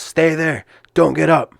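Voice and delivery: ref yelling. Retold or imitated. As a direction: bark the order to stay down. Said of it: "kinda yelling at me".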